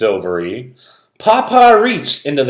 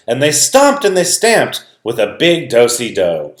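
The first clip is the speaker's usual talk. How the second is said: usual speaking voice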